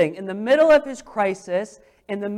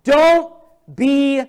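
higher, louder Don't be afraid; proclaiming; 3 semitones higher, 6 dB louder, much slower than usual. Acted. Preacher to congregation, proclaiming don't be afraid. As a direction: call it out with force.

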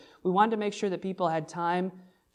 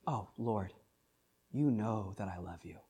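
low, soft, quiet reply; a hushed, humble answer; Peter answering softly; 7 semitones lower, 10 dB quieter than usual. Retold or imitated. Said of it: "and Peter says".

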